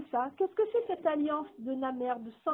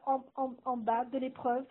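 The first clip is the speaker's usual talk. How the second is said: ordinary delivery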